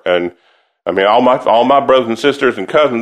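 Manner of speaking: ordinary delivery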